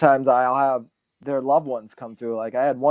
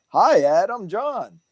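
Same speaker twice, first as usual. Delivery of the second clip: higher, sing-song friendly greeting; thrown voice of John; 7 semitones higher, slower than usual. Retold or imitated. Retelling the spirit's hello to his mentor, higher sing-song greeting. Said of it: "the way I threw my voice like that is how he".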